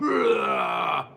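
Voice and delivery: a low, far-off mock villain laugh. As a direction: cackle like the giant, hammed up. Laughs after voicing Andre.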